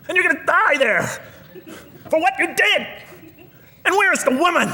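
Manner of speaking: high, mock-furious divine tirade; imitating an angry God listing punishments, high and ranting; raging God. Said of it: "I was expecting now the rage".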